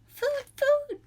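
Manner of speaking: very high, loud little gosling cry; hatchling cry. A narrator's reading. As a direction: squeak it out like a tiny hungry chick. Hungry gosling begging.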